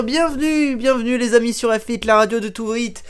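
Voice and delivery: high, loud hyped radio host intro; radio announcer. Acted. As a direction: sell the show like a jingle announcer.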